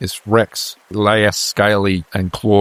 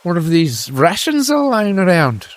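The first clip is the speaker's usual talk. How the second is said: higher-pitched soldier's voice; pressing deserter; 9 semitones higher than usual. Acted. Deserter eyeing farmer's rations, higher pressing voice. Game master plays a deserter.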